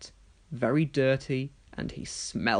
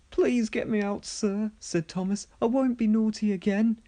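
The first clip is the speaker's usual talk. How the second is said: higher, small pleading engine; 7 semitones higher than usual. Acted. Thomas begging Sir Topham Hatt, higher pleading. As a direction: beg like a sorry little engine.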